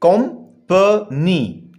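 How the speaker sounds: कंपनी split slowly into three syllables, com-pa-ny. Shown as a demonstration. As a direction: sound out कंपनी with long gaps between syllables. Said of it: "ये है जी three syllable". (کمپنی کو تین ٹکڑوں میں توڑ کر بولیں)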